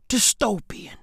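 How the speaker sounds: hushed whispered word; eerie whisper; ominous whisper. Acted. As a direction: whisper it darkly. Dramatic whisper.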